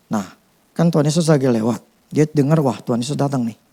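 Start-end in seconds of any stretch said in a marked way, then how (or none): none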